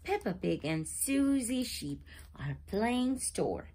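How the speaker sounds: usual speaking voice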